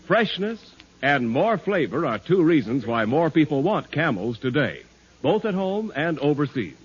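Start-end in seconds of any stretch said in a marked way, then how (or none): none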